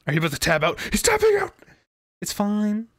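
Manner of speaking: normal talking voice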